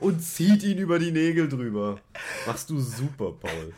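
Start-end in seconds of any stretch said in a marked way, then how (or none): 0.0-2.0 high, loud laughing complaint. Real, not acted. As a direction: laugh while you complain. Laughing complaint about friend's sleeve.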